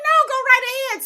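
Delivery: put-on high, cheerful old lady granting permission; bright high granny voice; old lady's voice. The old patient answering in a high old-lady voice. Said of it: "And she was like".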